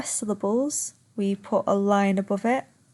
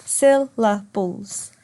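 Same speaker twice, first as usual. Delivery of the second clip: "syllables" slowly split, SYL louder than la-bles; "syllables" in three slow beats, heavy on the first; stress on first syllable; 4 dB louder, much slower than usual. Shown as a demonstration. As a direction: punch SYL, then say the rest of "syllables" slowly. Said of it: "We put the stress on the syl".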